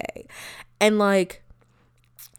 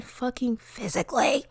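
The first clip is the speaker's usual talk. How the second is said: whisper; 3 semitones higher, 4 dB quieter than usual. Real, not acted. Whispered emphasis.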